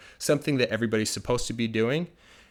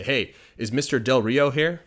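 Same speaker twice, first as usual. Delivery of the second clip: caller asking; 3 semitones higher, 6 dB louder than usual. Acted. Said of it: "somebody calls in ... And they say".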